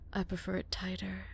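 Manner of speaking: soft, suggestive whisper. Acted. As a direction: murmur it close, teasing. Captive flirts with the officer.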